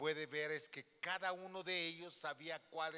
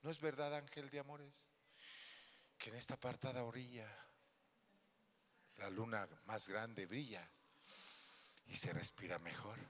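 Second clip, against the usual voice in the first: romantic recitation; 5 semitones lower, 5 dB quieter than usual. Retold or imitated. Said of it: "Yo llegué con mis flores".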